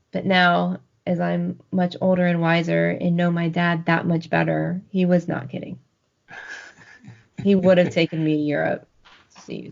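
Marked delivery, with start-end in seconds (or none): none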